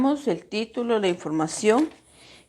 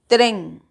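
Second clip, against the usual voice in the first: TREN said loud and falling, one firm beat; stressed syllable; 5 dB louder than usual. Shown as a demonstration. Teacher asking students to listen well, stress on TREN. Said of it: "escuche bien".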